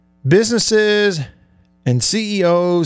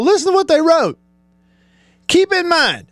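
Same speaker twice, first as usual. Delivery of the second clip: incredulous; 13 semitones higher than usual. Real, not acted. Host mocking Auburn's statement.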